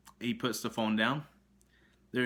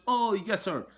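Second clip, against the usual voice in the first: higher and louder mock polite "yes sir"; high eager agent voice; 9 semitones higher, 5 dB louder than usual. Vlogger imitates the phone agent answering him, high mock eager voice. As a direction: chirp it like an overeager phone agent. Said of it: "In same voice".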